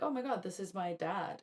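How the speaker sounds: normal talking voice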